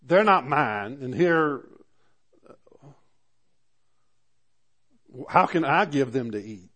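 a leader at the end of his rope; exasperated Moses. Retold or imitated. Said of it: "Moses finally had enough, said".

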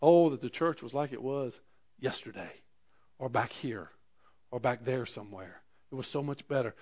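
imitating a churchgoer longing for yesterday; mock nostalgic lament. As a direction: pine for the good old days. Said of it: "You ever said that about the church".

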